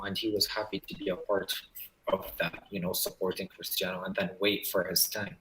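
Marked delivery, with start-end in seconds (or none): none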